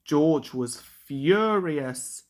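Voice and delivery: plain reading voice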